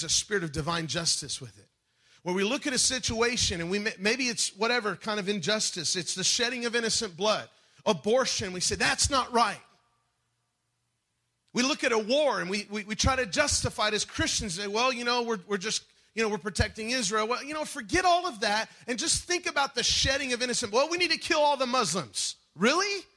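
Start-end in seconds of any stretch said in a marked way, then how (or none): 2.2-20.9 loud, higher preacher's cry building up; preaching at full force. Acted. 20.9-22.3 mock slogan. 22.6-23.0 much higher, louder disbelieving "Really?"; incredulous really. Real, not acted. Incredulous retort to the slogan.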